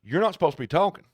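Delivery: stunned by a talking dog; mock shock; shocked owner. Owner's shocked reply voiced.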